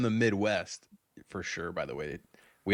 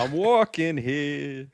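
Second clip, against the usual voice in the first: loud, slow New York cabbie shout; New York accent; 6 semitones higher, 5 dB louder, much slower than usual. Shown as a demonstration. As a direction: bark it like a New Yorker in traffic. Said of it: "my New York".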